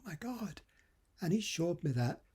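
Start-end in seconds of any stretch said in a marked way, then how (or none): 0.0-0.6 soft, high-pitched 'oh my God' of realisation; retold moment of awe, voice small and lifted; quietly astonished; hushed amazement. Amazed reaction to friend's answer.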